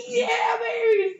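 high, loud, drawn-out victory whoop; retold cheer. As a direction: shout it slowly like a mock celebration. Acted celebration at sequel announcement.

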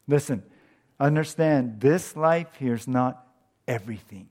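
usual speaking voice

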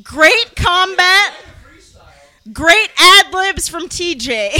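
loud, deliberate read-out with big pauses; grand proclamation. Acted. Podcaster reading her sober notes to the audience, grand proclamation. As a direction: proclaim each note to the room.

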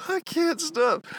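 laughing, words squeezed out; giggling through the words; still laughing. Real, not acted.

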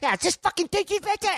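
very high mock rage; hammed-up angry retort; imitated screaming. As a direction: squawk back furiously, pitch way up. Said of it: "Bobby scream at you".

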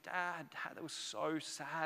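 soft, slow imitation of a sad little girl; grieved child. Three-year-old daughter telling dad about the film, softly grieved. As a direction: quietly imitate a child's mild grief. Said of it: "like, really grieved".

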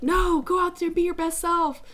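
high, pushy soccer mommy cheer; cheerleading parent pushing a kid onto the field. Retold or imitated. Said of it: "Maybe a little soccer mommy".

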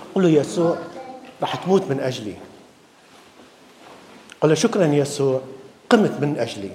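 soft, low prayer of gratitude; quiet thanks. Pretend. A believer thanking Jesus in prayer, soft and low. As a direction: pray it softly, close and intimate. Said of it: "راح أقول له".

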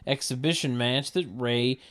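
ordinary talk

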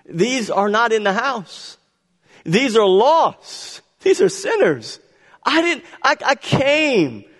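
high, emphatic preacher's rendering; Jesus pleading with Pharisees. Acted. Jesus explaining to Pharisees whom he came for, impassioned. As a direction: drive each short phrase home, climbing in pitch. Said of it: "He's trying to tell them".

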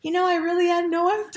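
high, loud, cracking up; laughing clueless reply. Retold or imitated. Quoting the companion who had no idea, helplessly laughing. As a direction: imitate her cluelessness through laughter.

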